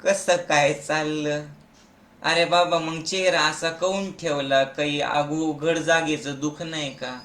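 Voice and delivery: lifeless person. Acted. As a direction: talk with all the energy gone. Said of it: "असं मरेल माणसाला बघितलं". (मरगळलेला, निरुत्साही आवाज)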